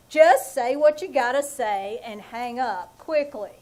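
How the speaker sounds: normal talking voice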